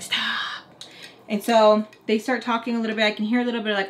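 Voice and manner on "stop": breathy whisper, no voice at all; whispered stop. Retold or imitated. Whispering what she overheard from upstairs. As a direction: hush it like words overheard through a wall.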